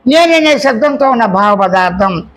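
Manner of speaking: usual speaking voice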